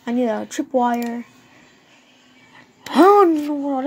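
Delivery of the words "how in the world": astonished. Real, not acted. Surprised at needing a tripwire.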